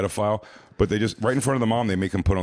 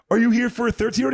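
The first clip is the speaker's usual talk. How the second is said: high, fast mock interrogation; accusing question; 4 dB louder, faster than usual. Sting crew confronting the man, mock accusing. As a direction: press the question, hammed up.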